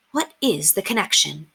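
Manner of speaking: ordinary delivery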